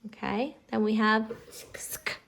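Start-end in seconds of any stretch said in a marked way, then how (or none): 1.8-2.2 "sk" as one isolated sound; blended sound. Shown as a demonstration. Teacher sounding out a new word, blended. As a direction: join "s" and "k" tightly in "sk". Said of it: "same sound".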